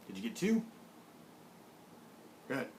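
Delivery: ordinary talk, nothing marked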